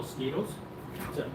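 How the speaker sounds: usual speaking voice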